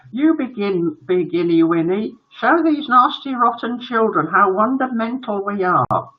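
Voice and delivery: put-on high baby-talk boy voice; prim little son. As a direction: urge daddy on, sweetly superior. Son urges father to begin.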